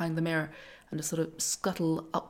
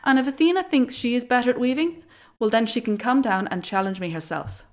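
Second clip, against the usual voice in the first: loud, higher defiant taunt; defiant challenge; 7 semitones higher, 10 dB louder than usual. Acted. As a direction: dare the goddess to come down. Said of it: "replied Arachne".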